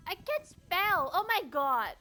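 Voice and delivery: loud, drawn-out frustrated cry; exasperated outburst. Real, not acted. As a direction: blurt it out, fed up with yourself. Sudden outburst at own spelling.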